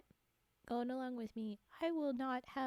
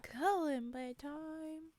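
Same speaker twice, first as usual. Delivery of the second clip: slow announcement; 5 semitones higher, much slower than usual. Real, not acted. Announcing the next segment.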